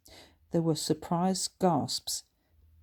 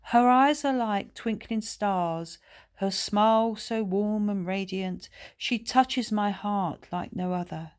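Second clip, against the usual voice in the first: recited love poem; 4 semitones higher than usual. A narrator's reading. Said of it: "he recited".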